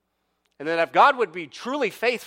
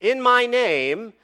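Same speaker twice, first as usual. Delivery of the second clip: loud, high, ringing declaration; forceful scripture proclamation; powerful; 8 semitones higher, 8 dB louder, slower than usual. Acted. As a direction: proclaim it slowly, phrase by phrase. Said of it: "He makes this powerful statement".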